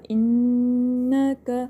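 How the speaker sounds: انکا with long nasal noon, then brief "ka"; short final vowel. Shown as a demonstration. Teacher shows students the timing, انکا ending on a short vowel. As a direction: hum the n in انکا, keep the last vowel to one beat. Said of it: "بس ایک second جتنا time دینا ہے".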